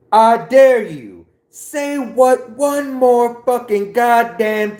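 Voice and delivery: high, loud, enraged shouting; full-force acted rage, stretching the threats; furious movie tirade. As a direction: yell it as a threatening interrogation. Acted movie quote of furious interrogation.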